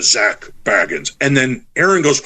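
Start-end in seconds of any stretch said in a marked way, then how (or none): 0.0-1.1 lightly voiced low spirit recording; a hint of a ghostly intonation. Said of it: "you hear the voice go".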